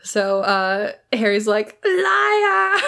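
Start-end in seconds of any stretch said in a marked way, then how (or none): 1.9-2.8 high, drawn-out mock shout; hammed-up accusing yell. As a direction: cry it out as an over-the-top accusation. Harry's accusation voiced in retelling.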